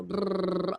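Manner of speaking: rapid rolled brrr; machine gun vibrato. Shown as a demonstration. Describing a Broadway singer's vibrato, rapid-fire trill. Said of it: "machine gun vibrato".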